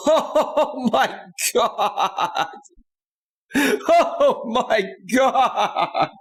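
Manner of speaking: drawn-out laughing oh my God; slow, stretched and laughing. Real, not acted. Streamer laughing in disbelief at a viewer's donation.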